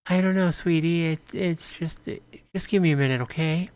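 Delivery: put-on mother calming her hungry child; high mother's voice. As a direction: soothe the child while stalling. Voicing the mother soothing child.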